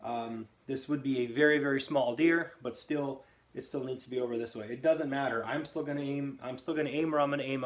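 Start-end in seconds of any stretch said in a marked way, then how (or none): none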